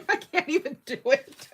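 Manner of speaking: helplessly cracking up; real laughing fit. Real, not acted. Giving up on imitating her friend, cracking up.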